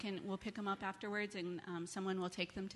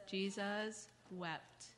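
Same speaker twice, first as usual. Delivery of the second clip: very slow, spaced-out words; leading recitation; 4 dB quieter, much slower than usual. Shown as a demonstration. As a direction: lead a group recitation, drawing out each word. Children recite verse after her.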